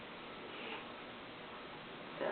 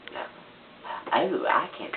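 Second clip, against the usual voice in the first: normal talking voice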